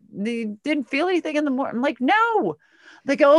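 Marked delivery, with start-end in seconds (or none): 2.0-2.6 high-pitched mock-innocent no; overdone innocent denial. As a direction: deny it too eagerly. Retold denial of any pain.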